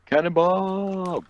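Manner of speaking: drawn-out high yell; cannonball yell. Real, not acted. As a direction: yell it while leaping. Jumping off ledge into pool.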